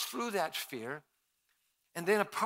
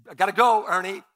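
loud, higher nervous brush-off; hasty excuse; 5 semitones higher, 12 dB louder than usual. Retold or imitated. Speaker fleeing Ernie's confession, loud nervous excuse. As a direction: blurt the excuse to escape. Fleeing awkward witness moment.

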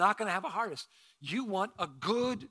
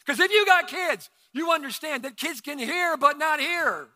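loud, high, knowing exclaim to parents; excited exclaim; 8 semitones higher, 7 dB louder than usual. Real, not acted. Preacher joking with the parents in the congregation, loud. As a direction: punch it with a knowing laugh in the voice.

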